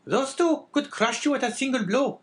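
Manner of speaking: very high, loud woman's character voice; warning wife. Acted. Wife warning her husband about powerful men, high-voiced.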